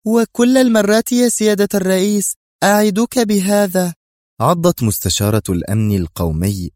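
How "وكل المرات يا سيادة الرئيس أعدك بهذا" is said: high, smug promise; villainous leader gloating; taunting villainess. A narrator's reading. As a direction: savor the threat. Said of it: "في سرعة".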